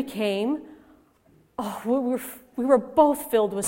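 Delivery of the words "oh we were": ordinary delivery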